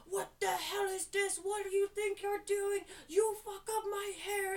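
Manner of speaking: high, loud mock yell of outrage; imitated angry yelling. Angry client yells at stylist over botched haircut, outraged yelling. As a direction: yell it like a furious customer. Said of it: "He yelled at me so bad".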